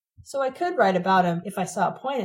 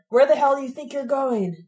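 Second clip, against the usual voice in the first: angry father's bellow, louder and higher; thundering; 6 semitones higher, 5 dB louder than usual. A narrator's reading. Father catching teens sneaking in, thundering. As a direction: thunder it down the stairs. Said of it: "a voice thundered".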